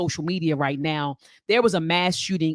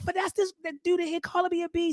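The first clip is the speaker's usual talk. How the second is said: put-on high, upset girl's voice on the phone; high-pitched teenage complaint to a big brother; upset teenage self; 12 semitones higher, 4 dB quieter, faster than usual. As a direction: retell it as her sixteen-year-old self, pitched way up. Teen girl calling big brother.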